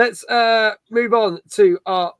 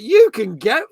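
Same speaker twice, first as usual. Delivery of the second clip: mock outrage; 11 semitones higher, much slower than usual. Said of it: "And everyone was like".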